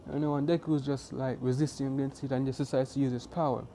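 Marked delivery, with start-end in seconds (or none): none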